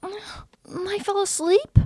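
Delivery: high, soft, slow and bewildered; sleepy timid Cookie. Acted. Cookie waking in bed, high timid confusion. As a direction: sound small and just woken.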